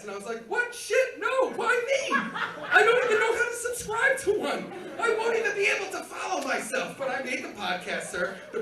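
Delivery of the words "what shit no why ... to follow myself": high, loud, incredulous balking; panicked protest. Retold or imitated. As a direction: protest loudly in a high-pitched voice, appalled. Balking at being told to podcast.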